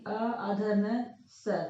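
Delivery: slow, letter-by-letter spelling of अ आधा न स; spelled out slowly. Shown as a demonstration. Teacher shows pupils the wrong spelling, slow and deliberate. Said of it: "तो हम अंश को ऐसे नहीं लिखेंगे".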